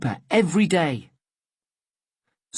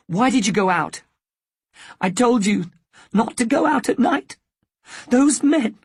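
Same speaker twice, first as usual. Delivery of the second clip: rising into a high, loud, upset scold; a frightened mother scolding her son, building to a cry; angry scolding; 7 semitones higher, 3 dB louder than usual. Acted. Mother scolds son after danger.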